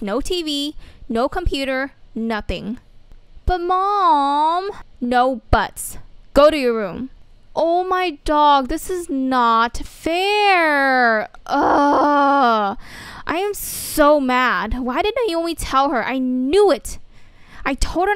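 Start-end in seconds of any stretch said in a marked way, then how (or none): 3.5-4.7 whiny protest. Acted. Protest at being grounded. 5.0-7.1 stern scolding. Acted. Mom grounding her daughter. 7.5-14.8 loud, slow, fed-up groan of complaint; teen huffing loudly at the unfairness; frustrated outburst. Acted. Daughter angry after being grounded.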